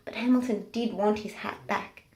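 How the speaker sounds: plain reading voice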